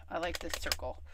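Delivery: hushed little whisper. Real, not acted. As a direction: whisper it to yourself. Whispered aside while stamping.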